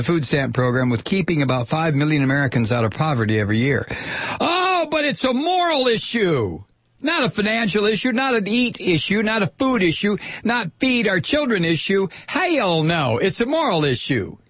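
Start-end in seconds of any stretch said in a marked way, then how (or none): 4.4-14.4 high, mocking sarcastic outrage. Real, not acted. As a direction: sneer it back at them. Sarcastic mockery of Republican line.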